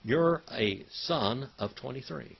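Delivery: normal talking voice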